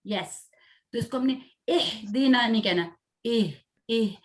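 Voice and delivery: ordinary talk, nothing marked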